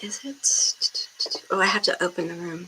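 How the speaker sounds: hushed whisper while setting up. Real, not acted. Streamer whispering to herself before going live. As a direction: whisper so the stream won't hear.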